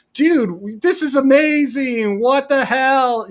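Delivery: high-pitched mock fanboy excitement; imitating an astonished fan's email; amazed fan. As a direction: gush like a blown-away fan, stretching the last words.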